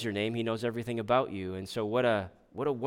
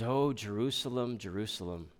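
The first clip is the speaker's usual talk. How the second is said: lament; 4 semitones lower, 6 dB quieter than usual. Retold or imitated. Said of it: "he says".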